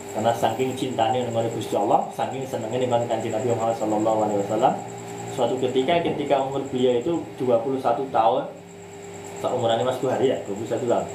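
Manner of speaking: ordinary delivery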